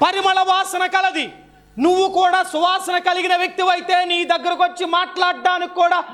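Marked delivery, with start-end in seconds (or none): 0.1-1.3 shouted high and slow for emphasis; emphatic shout. Shown as a demonstration. Preacher repeating the answer to the crowd, shouting slowly. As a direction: drive the point home with a shout. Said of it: "ఎలాంటిది?".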